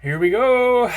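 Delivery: high, loud thrilled call; excited. Real, not acted. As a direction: burst out with glee. About to open the blade.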